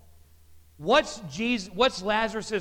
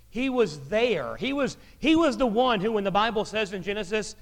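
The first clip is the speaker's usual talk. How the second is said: ordinary talk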